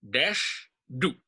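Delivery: "Desh", a gap, then a stressed "Du"; stress on both syllables. Shown as a demonstration. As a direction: hit "Desh" and "Du" as two separate beats. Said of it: "So Du is also taking on some stress".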